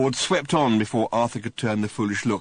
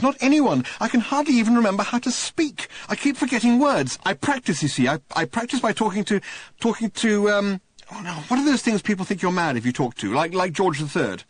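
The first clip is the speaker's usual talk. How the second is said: raised, louder bewildered castaway; high, overwhelmed Arthur blurting it out; voiced Arthur, emotional; 11 semitones higher, 4 dB louder than usual. Lonely Arthur greeting Ford, emotional and high.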